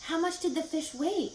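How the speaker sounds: high, eager question; eagerly. Acted. Wilbur eagerly asking Charlotte about the fish. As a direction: lean in wanting to know. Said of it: "asked Wilbur eagerly".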